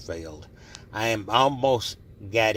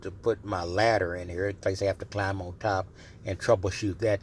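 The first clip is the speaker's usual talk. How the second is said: usual speaking voice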